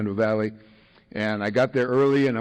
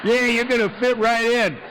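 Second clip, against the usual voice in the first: put-on grinning old-timer; 10 semitones higher than usual. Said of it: "this guy's got a big grin".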